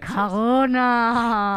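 slow drawn-out taunt that ends in a loud shout; mock her like a vengeful God.

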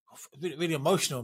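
words tumble out, choked up; emotional, voice breaking. Real, not acted. Host moved by guest's story, voice breaking. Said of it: "I'm emotional because I've heard this".

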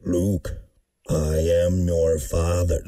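very low, slow villain voice; deep menacing movie villain; Darth Vader voice. Retold or imitated. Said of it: "That was pretty good".

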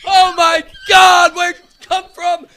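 high mock astonishment; amazed shout. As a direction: shout like a stunned commentator.

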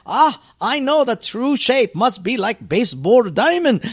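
hammed-up eager pastor in a Japanese accent; mock pastor. Mocking pastors in broken English.

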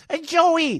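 put-on high, loud Italian-accented call of his name; Italian grandma calling. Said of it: "with the thick Italian accent".

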